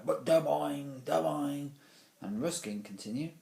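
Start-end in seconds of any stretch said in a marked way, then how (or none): 0.0-1.7 loud, high, drawn-out boings; louder and louder. A narrator's reading. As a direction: imitate a ball bouncing ever harder. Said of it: "got louder and louder".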